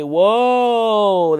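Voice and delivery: high, loud, amazed whoa; excited whoa. Shown as a demonstration. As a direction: let it burst out in wonder. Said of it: "When you hear me say".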